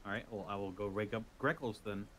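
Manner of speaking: ordinary talk, nothing marked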